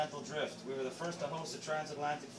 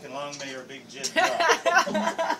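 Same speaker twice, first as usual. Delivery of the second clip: higher, louder, slow and laughing; loud laughing delivery; 11 semitones higher, 9 dB louder, much slower than usual. Acted. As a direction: boom it out with a laugh. Host booming the phrase, laughing.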